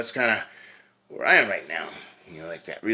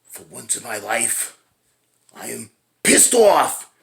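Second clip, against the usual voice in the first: mock angry Julius; 8 dB louder, slower than usual. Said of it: "he's getting kinda mad".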